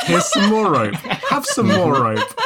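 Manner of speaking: teasing GM voice. Retold or imitated. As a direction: egg them on, laughing. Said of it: "she was just like".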